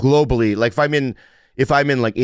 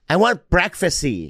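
whiny date imitation; 6 semitones higher, slower than usual. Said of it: "my date was like".

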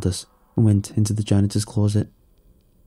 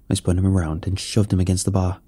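plain reading voice

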